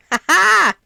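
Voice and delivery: triumphant laugh. Real, not acted. Gloating to listeners about her trademark, loud laugh. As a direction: crow with a loud laugh of victory.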